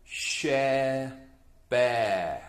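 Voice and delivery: slow reading together; title read in chorus with the children; choral title read. Shown as a demonstration. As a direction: read it aloud with the class. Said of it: "One, two, three".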